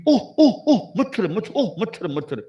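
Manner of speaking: high startled cries, swatting at a mosquito; flustered yelp. Acted. Guru bitten by a mosquito, yelping high and startled. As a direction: yelp in panic at the bite.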